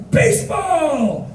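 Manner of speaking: high, drawn-out shout; rousing farewell. Retold or imitated. Bus driver bids tourist farewell, high drawn-out cry. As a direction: call it back like a battle cry.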